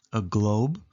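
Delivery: plain reading voice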